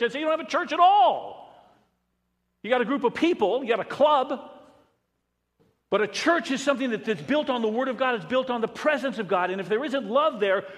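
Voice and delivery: loud, high, driving sermon voice; full-force preaching. Acted. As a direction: preach it hard to the congregation.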